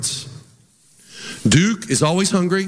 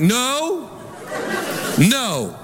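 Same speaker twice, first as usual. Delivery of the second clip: stern no; 8 semitones higher than usual. Retold or imitated. Preacher stopping Duke lifting his head, firm. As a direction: warn him off, firm. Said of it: "I'm like".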